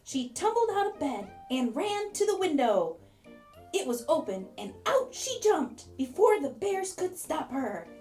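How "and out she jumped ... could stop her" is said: usual speaking voice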